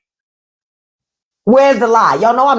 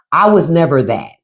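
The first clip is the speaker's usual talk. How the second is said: usual speaking voice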